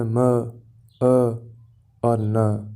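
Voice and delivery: म, अ, न as separate sounds with pauses; sounded-out phonemes. Shown as a demonstration. Teacher splitting a syllable into three phonemes, sounded out singly. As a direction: break "man" into म, अ, न, sound by sound. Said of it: "मैन को तीन phonemes मिलके बनाती".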